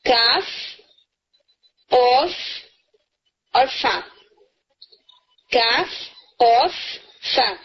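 کاف، قاف، فا each said on its own; name کاف قاف فا clearly, one by one; letter names. Shown as a demonstration. Teacher listing the three letters, deliberate. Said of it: "three letters ہیں".

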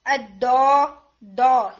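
دو said loud and hard from the tongue tip; dal sound. Shown as a demonstration. Teacher to students, giving the dal sound for contrast. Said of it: "دال کی جو sound ہے".

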